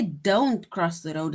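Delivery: slow firm order; commanding. Shown as a demonstration. Teacher demonstrating a command to the class, slow and firm. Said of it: "like somebody commanding you".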